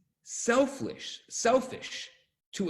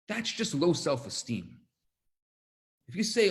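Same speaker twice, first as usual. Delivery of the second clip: ordinary talk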